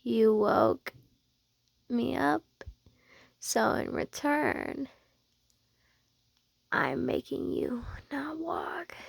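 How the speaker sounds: slow, hushed sleepy threat; soft teasing threat. Acted. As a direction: murmur it slowly, leaving pauses. Sleepy teasing threat, spoken low.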